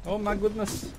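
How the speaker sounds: high squeaky astonishment; a high, stunned oh my goodness; high amazed exclaim. Real, not acted. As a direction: gasp it out, high up. Battle going wild.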